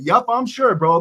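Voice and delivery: high, cocky reply; mock bravado. Prisoner daring the guard, high cocky voice. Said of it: "I said".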